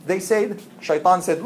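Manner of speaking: ordinary delivery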